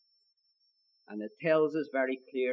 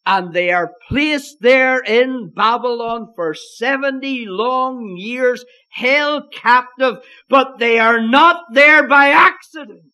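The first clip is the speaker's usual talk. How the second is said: climbing louder and higher to a ringing peak; rising pulpit shout; 8 semitones higher, 12 dB louder, slower than usual. Real, not acted. Preacher to his flock, building to a ringing full-voiced climax. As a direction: drive it home to the congregation.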